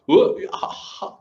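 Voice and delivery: shocked monk caught off guard. Acted. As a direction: gasp it out, unable to believe she knows. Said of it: "this monk is shocked".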